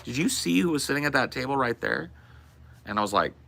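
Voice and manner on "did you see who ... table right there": waitress voice. Retold or imitated. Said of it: "repeated waitress line".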